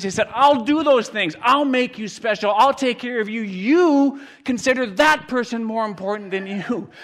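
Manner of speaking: loud, high, shouted preaching; full-force preaching. Acted. Preacher tells congregation Jesus went to die, full-force shout. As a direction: drive it home at the top of your voice.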